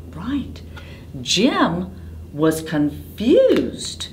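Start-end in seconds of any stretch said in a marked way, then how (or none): 0.1-0.6 whisper. A narrator's reading. Suspenseful story moment.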